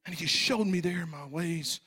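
ordinary talk, nothing marked

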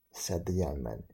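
ordinary delivery

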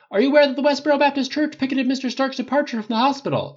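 high, loud shouted question; urgent high-pitched press holler; clamoring reporter. A narrator's reading. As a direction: shout it over a press scrum. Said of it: "The reporters clamored as one".

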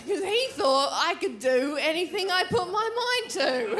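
ordinary delivery

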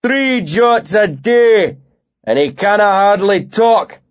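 northern bully shouting over his shoulder; shouted to the room. Acted. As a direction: call the whole bar to laugh along. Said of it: "he shouted".

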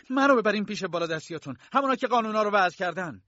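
angry demand. Acted. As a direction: demand to see their superiors. ناگهان به سمت فرانس هجوم آورد. (مطالبهٔ تند و بلند از فرانس)

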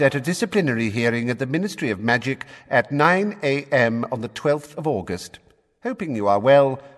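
a touch of a crisp official reading; official letter. A narrator's reading. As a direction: read it like a Ministry letter. Letter read out.

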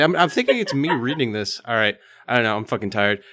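normal talking voice

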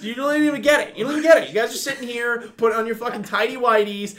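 high-pitched, worked-up tirade; comic rant. Real, not acted. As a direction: rail at them, exasperated and half joking. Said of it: "rant over".

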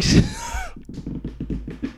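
high, amused laugh; sudden squeal of a laugh; burst of laughter. Real, not acted. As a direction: cracks up at the absurdity. Laughs at the guy's nerve.

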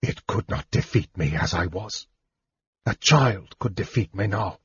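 soft, weak bitterness; a spent mage's hushed, bitter admission; quietly bitter. A narrator's reading. Weakened mage admitting his frailty to Tanis, softly bitter. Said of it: "he said bitterly".

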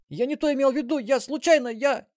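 high, loud hurried excuse; panicked babbling. A narrator's reading. Robber excusing himself to Melissa, high panicked babble. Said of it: "ещё успел пролопотать грабитель".

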